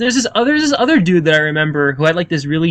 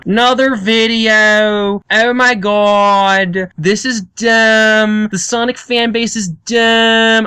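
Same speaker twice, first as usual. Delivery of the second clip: very slow, higher monotone; emotionless drone; 5 semitones higher, much slower than usual. Retold or imitated. Mocking a bored commentator, very slow monotone drone. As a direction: draw every word out dead and bored. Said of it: "without any emotion at all".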